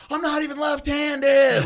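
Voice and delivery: drawn-out, high full-volume scream. Retold or imitated. Re-enacting his fight for friends, screaming. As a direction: yell it slow and high, like a drunken victory cry. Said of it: "Now I start screaming".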